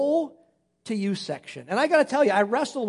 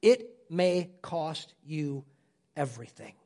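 hushed grave warning; 6 semitones lower, 5 dB quieter than usual. Acted. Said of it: "he would say it like this".

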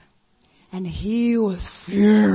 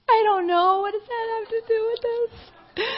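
much higher, teary and pleading; crying; 14 semitones higher, 3 dB louder than usual. Retold or imitated. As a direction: cry out the words like a hurt young woman.

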